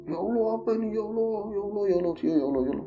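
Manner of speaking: high, frightened calls to God; fearful pleading, voice pitched high; terrified praying. Retold or imitated. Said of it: "bener-bener dia ketakutan banget".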